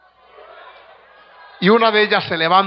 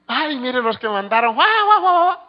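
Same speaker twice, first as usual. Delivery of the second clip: high, strange cackling taunt, much higher and louder; mocking demon voice; 8 semitones higher, 5 dB louder than usual. Demon in the possessed woman mocks the young men, shrill cackling taunt. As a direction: jeer like a demon laughing at the newcomers. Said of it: "se oían las carcajadas de los demonios".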